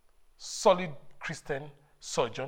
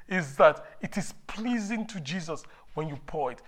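ordinary talk, nothing marked

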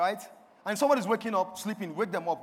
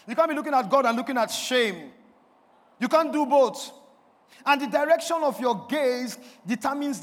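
raised, emphatic pulpit delivery; forceful preaching; 5 semitones higher, 7 dB louder than usual. Real, not acted. Preacher warning you can't look at God and shame, forceful preaching. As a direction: drive each repeated point home loudly.

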